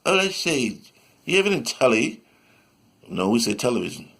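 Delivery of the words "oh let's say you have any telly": higher-pitched mock British accent; playful British impression. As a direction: put on a British speaker. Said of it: "talk with the great British".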